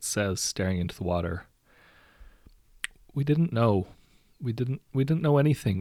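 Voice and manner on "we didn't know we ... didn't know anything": higher, pleading first mate; lightly acted remorseful plea; confessing first mate. A narrator's reading. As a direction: stumble through an excuse, pitched up.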